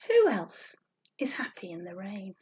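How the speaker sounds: ordinary delivery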